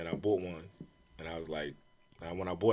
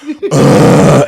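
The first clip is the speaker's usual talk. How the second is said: loud, nasty imitated groan of disgust; evil crowd groan; 14 dB louder than usual. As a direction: let out a hostile audience's groan. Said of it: "It was like an evil ass".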